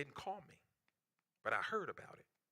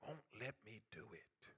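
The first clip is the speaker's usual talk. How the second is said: hushed drop; 5 semitones lower, 15 dB quieter than usual. Acted. Sudden drop after loud preaching.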